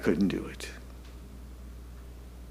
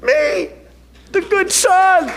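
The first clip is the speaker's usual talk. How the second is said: high, slow mock-pious good son; mock your own innocence; wry irony; 10 semitones higher, slower than usual. Real, not acted. Pastor mocking himself as the good son, high and slow. Ironic self-mockery.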